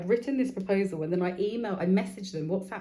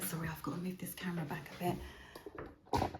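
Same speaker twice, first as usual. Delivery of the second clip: whispered aside; 11 dB quieter than usual. Real, not acted. Aside while adjusting the camera.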